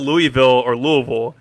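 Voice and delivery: first Louisville as LOO-ee-vill, then LOO-vul; contrasting pronunciations. Shown as a demonstration. Host repeats his question, Louisville as LOO-ee-vill, then LOO-vul. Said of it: "How do you pronounce".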